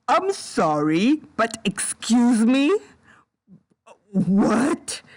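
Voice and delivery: drawn-out disbelief, every phrase rising into a question; incredulous. Real, not acted. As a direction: stretch the words in stunned offence. Incredulous reaction to the confession.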